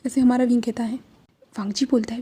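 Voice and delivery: plain reading voice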